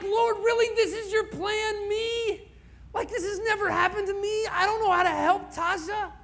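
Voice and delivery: anguished cry. Real, not acted. Pastor crying out to God over the dead baby, anguished. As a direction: plead with God through tears.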